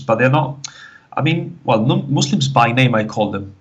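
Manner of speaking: ordinary talk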